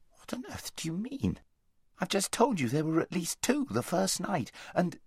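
put-on high, protesting young boy; indignant boy voice. A narrator's reading. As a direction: push back, bewildered and high.

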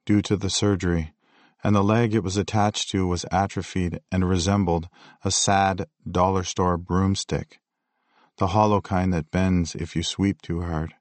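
ordinary delivery

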